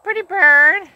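high sing-song pet voice; coaxing the parrot to talk. Real, not acted. Talking to a parrot.

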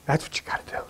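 hushed conspiratorial whisper. Real, not acted. Preacher to audience, whispering the lesson. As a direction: lean in and whisper it.

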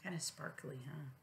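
gentle hushed comment; soft aside. Real, not acted. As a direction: quietly point out the picture. Asides to child over picture book.

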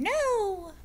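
high, squeaky mouse no; little mouse saying no. Acted. Teacher voicing the little mouse in the book, high squeak. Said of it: "The little mouse is saying".

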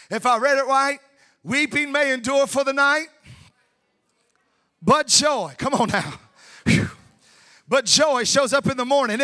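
loud, ringing sermon climax; preaching at full force. Acted. Preacher rousing the congregation, loud full-force preaching. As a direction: preach it out to the whole room.

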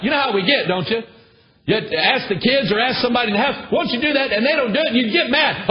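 high, loud, driving sermon delivery; preaching at full force. Acted. Preacher rebuking the church for wanting to be needed, fiery. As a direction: pound the rebuke into the congregation.

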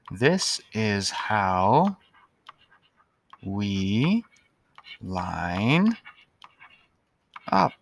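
drawn out word by word; slow dictation. Shown as a demonstration. Teacher dictating to pupils, slowly. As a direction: write it out slowly aloud.

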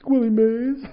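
put-on high, timid kid's answer; small hopeful question.

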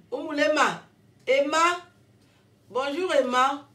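appel plus aigu et plus fort; salut enthousiaste. Real, not acted. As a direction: lance le bonjour à Emma avec joie. Salue Emma avec éclat. (elle salue Emma avec enthousiasme)

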